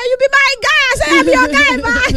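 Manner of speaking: high, loud, gleeful proclamation; excited declaration. Acted. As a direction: squeal it out with delight. Excitedly claiming fan as friend.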